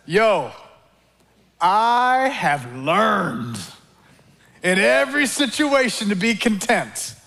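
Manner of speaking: lightly voiced casual Paul, slow and drawn out. Preacher voicing Paul from jail, a hint of slow casual cool. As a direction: say it laid-back, stretching learned. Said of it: "he says".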